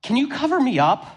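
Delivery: put-on sleepy toddler ask. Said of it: "And he goes".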